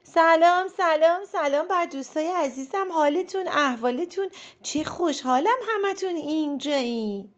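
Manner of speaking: high, loud booming greeting; loud Mrs Grape. Acted. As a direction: greet everyone at full volume, big and jolly. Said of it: "با صدای بلند به همه گفت".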